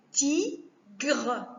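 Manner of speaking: "tigre" slowly split as ti, gre; split into syllables. Shown as a demonstration. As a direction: say "tigre" in two separate beats, ti... gre. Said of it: "Je répète".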